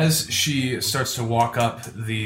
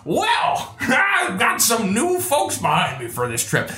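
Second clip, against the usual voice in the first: put-on old wagon driver; 7 semitones higher than usual. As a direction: boom it out like a grizzled driver.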